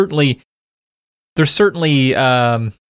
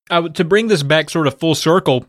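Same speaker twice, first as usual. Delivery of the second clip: ordinary delivery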